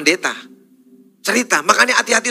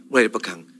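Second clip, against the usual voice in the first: normal talking voice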